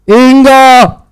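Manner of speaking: the hidden voice calls the name again; mysterious calling voice. Acted. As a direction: call her name from somewhere hidden.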